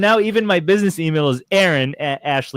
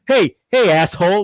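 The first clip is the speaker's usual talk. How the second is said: angry customer; 4 semitones higher, 6 dB louder than usual. Retold or imitated. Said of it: "they don't get angry because it's Aaron".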